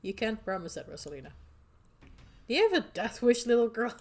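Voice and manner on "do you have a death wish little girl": scolding. Acted. Companion scolding reckless teen.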